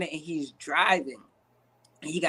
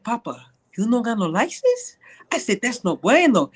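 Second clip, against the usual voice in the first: put-on broken English with Spanish accent; 3 semitones higher than usual. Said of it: "I just use the accent".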